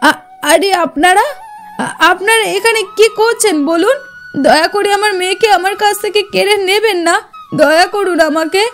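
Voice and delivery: acted startled, stammering plea; tearful pleading. Parent begs not to lose daughter.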